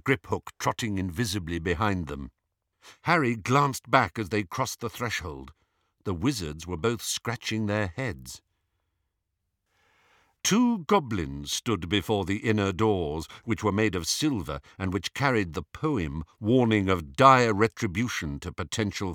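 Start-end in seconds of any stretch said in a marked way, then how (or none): none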